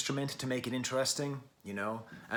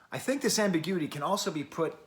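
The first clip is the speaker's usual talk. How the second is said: ordinary talk, nothing marked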